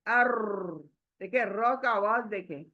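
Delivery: ordinary talk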